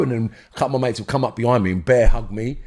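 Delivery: ordinary delivery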